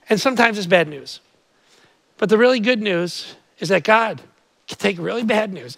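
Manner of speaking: ordinary talk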